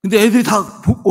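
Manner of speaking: ordinary talk, nothing marked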